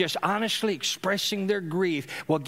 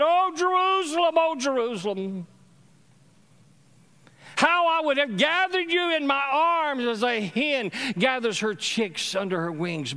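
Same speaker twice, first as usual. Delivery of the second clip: Jesus lamenting; 7 semitones higher than usual. Retold or imitated.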